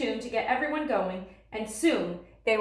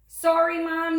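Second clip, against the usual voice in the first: higher, louder hurried apology; yelling; 7 semitones higher, 6 dB louder than usual. Acted. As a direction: call out as she rushes in. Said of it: "yelled Laura".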